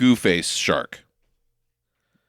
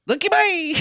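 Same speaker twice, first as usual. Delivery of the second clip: very high, silly mock voice; high-pitched voiceover. Imitating the chopper pilot, very high-pitched. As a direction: squeal it like a garbled dub. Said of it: "nonsensical voiceover in a high-pitched voice".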